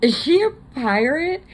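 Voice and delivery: high, loud incredulous question; can't believe what she heard; amused disbelief. Retold or imitated.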